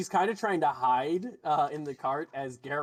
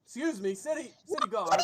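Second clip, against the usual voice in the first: higher, building call to the guard; lightly voiced fish-folk merchant calling the guard; 5 semitones higher than usual. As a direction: flag down the guard, rising pitch. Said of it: "and he goes".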